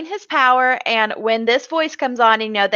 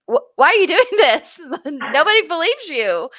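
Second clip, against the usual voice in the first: exasperated Cody; 6 semitones higher than usual. Retold or imitated. Cody confronting David over the power, mock exasperated. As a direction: beg him to just admit it. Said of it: "he's like".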